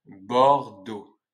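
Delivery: BORDEAUX split as Bor, deaux; slow, loud BORDEAUX in two syllables; syllable-by-syllable demo. Shown as a demonstration. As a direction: break BORDEAUX in two for learners. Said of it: "tu as appris à bien prononcer".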